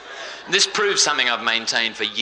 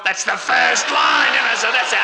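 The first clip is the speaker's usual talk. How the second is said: high, stretched-out yell over the laughs; comic shouting; 9 semitones higher, 5 dB louder than usual. Real, not acted. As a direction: shout it out to cut the joke short. Shouting over audience laughter at parody.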